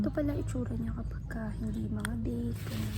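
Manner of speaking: hushed whispered aside; whispering. Real, not acted. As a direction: whisper it close to the mic.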